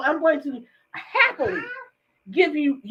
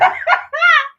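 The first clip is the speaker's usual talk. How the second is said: loud high laugh; 13 semitones higher, 5 dB louder than usual. Real, not acted. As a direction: burst out laughing. Laughing at own joke.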